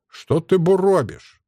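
slow, indignant challenge; angry roar. A narrator's reading. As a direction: roar it with restrained volume. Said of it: "ревёт Гнус".

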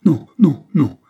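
each "non" punched high and loud; emphatic. A narrator's reading. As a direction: hammer every "non" as a separate high, forceful beat. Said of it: "répéta mademoiselle avec emphase".

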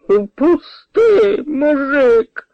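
very high, loud drawn-out squeal; squealing protest. Acted. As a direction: stammer and squeal to be let go. Daragan struggles in an iron grip.

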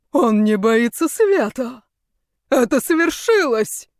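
slow, loud exultant villain; mad scientist. Acted. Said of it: "Как сумасшедший учёный ... он стал ликовать".

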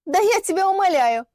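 loud, high scoff of mocking disbelief; derisive, near laughing. A narrator's reading. Girl ridicules Лилиана's faith in Вадим, derisive. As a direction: dismiss it as if about to laugh. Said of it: "Я едва не засмеялась в голос".

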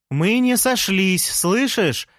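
high, drawn-out indignant call; emphatic high-pitched denial called from a window; shouting outside. A narrator's reading. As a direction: shout it slowly down to the street. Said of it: "крикнула она на улицу".

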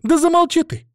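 высокий громкий окрик; рявкни, чтобы заткнуть; резкий окрик. Acted. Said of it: "внезапно рявкнула на него жрица". (резко и громко рявкнуть)

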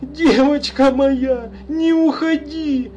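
high, slow despairing shout; loud anguished cry. Acted. Father cries to his daughter's coffin, anguished shout. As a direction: cry out after the lost daughter. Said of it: "громко кричал мужчина".

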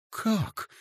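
high, soft startled squeak. A narrator's reading. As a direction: squeak it out in shock. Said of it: "пискнул Коротков".

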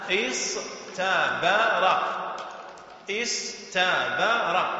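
اس تا با را spelled out slowly, one syllable at a time. Shown as a demonstration. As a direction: sound out اس تا با را syllable by syllable. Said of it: "فنقول با". (تهجئة بطيئة مقطعا مقطعا)

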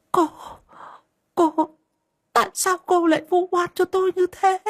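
very high, stammering indignation; high old-woman voice, stung by a false accusation; aggrieved old woman. A narrator's reading. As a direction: sputter a wronged protest at a high pitch. Accused old woman confronts daughter-in-law.

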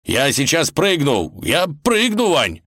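high, strained promise; shouting. Acted. Wolf promises Ivan he will jump across, shouting. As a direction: call out urgently, pitch climbing. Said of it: "закричал он".

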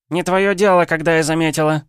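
plain reading voice

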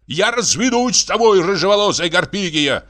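mild theatrical yell; angry cry. A narrator's reading. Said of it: "крикнул Бутронце".

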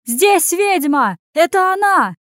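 high, loud alarmed bawl; full-voice yell. A narrator's reading. Innkeeper raises the alarm about the witch, yelling. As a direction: scream the alarm, drawn out. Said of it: "завопил во весь голос".

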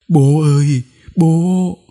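high, loud wail calling for father; wailing cry. Acted. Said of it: "Tôi gào lên khóc". (gào khóc gọi bố)

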